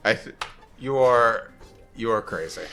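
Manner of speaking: ordinary talk, nothing marked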